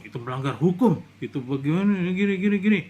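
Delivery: loud, scolding imitation of the commander; mimicking a general scolding loudly; mock stern general. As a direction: ham up a barking officer's rebuke. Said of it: "nggak usah pakai nada tinggi begitu".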